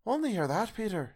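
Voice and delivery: very high mother's voice; mother exclaiming to her son, pitched high; Missus Cratchit. A narrator's reading. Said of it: "said Missus Cratchit".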